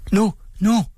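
put-on high, eager kids' cry; children shouting. A narrator's reading. Children crying out for the gifts, high and eager. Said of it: "råbte børnene".

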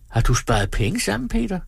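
teasing question, lifted high on the name. A narrator's reading. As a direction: tease him, pitch leaping at the end. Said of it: "drillede hun".

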